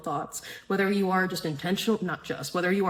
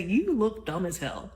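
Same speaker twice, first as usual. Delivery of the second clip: inner critic voice. Retold or imitated. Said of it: "She did start to say".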